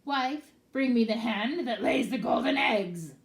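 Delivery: loud, gruff giant barking at his wife; booming ogre voice. Acted. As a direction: boom it out like a giant.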